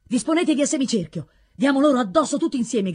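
rally the men to attack; urgent leader's call; shouted orders. A narrator's reading. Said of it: "gridò loro Torrismondo".

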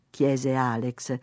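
ordinary delivery

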